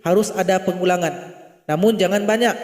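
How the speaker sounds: normal talking voice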